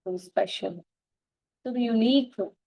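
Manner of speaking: ordinary talk, nothing marked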